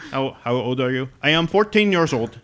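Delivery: high, loud imitation Indian accent; mock Indian accent. Mimicking the caller back, loud mock Indian accent. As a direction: play it up in a put-on Indian accent. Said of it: "I was doing it in an accent".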